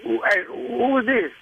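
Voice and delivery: ordinary talk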